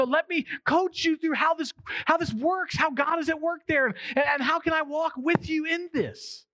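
slow, high, gentle coaching; coaching parent. Retold or imitated. Parent offering to walk a hurting kid through, gentle and slow. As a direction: guide your child through it tenderly. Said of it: "come to me and go".

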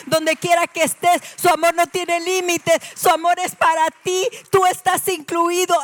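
loud, high, impassioned proclamation; full-force preaching. Real, not acted. Preacher telling the congregation God loves them, full-force preaching. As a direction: drive each phrase out to the whole congregation.

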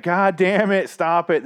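exasperated yell at the screen; frustrated outburst. Retold or imitated. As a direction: blurt it out fed up. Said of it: "And it's just like".

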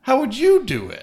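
high-pitched put-on voice. Signing off the show to the co-host, silly high put-on voice. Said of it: "I don't know why I'm using that voice".

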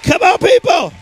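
preacher hyping the crowd to clap and shout; rousing call. Real, not acted. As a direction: whip the congregation into a shout.

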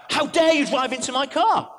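a retold furious driver, half-shouted; retold shouting. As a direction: play the furious stranger yelling at her. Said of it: "starts shouting at her".

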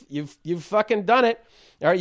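ordinary talk, nothing marked

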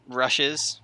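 normal talking voice

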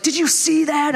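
put-on high-pitched childlike amazement; excited kid. Kids in the grocery cart watching things fall, excited. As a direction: pipe up like a thrilled child. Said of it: "the kids are like".

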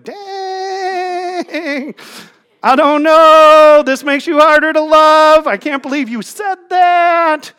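high, stretched-out mock reaction; loud, held-out words on a high note; drawn-out dismay. Reader reacting to what friends post online, drawn-out dismay.

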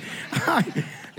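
airy unvoiced laugh; breathy laugh. Real, not acted. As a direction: let out a breathless giggle. Laughs after punchline.

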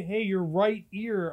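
slow, spelled-out your right ear; slow explicit. Retold or imitated. Mocking over-clear directions about a dirty ear, slow. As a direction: say it slowly as to a child. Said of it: "Or am I supposed to say".

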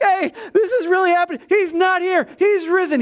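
high, fast, laughing excitement; an angel who can't contain his joy; giddy angel. Retold or imitated. The angel giddily telling the women he's risen. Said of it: "He was probably just as giddy as anybody".

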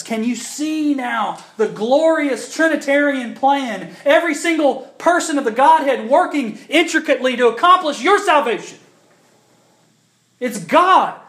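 loud, high pulpit proclamation; full-force preaching. Acted. To the congregation about creation, preached loud. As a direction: preach it out with conviction.

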